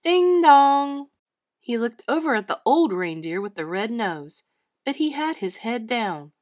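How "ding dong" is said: high, sustained bell chime; sung-out ding-dong, held on the dong; ringing bell. A narrator's reading. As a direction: imitate a church bell ringing. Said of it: "heard a faint, ringing sound".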